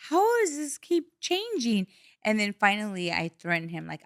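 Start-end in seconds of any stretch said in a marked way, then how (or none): 0.1-1.9 mad, exasperated. Retold or imitated. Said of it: "I was so mad".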